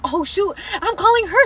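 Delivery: high-pitched, alarmed re-enactment; mock panic. Daughter re-enacting realising she is calling mom: high, panicked. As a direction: let pitch leap with dawning alarm.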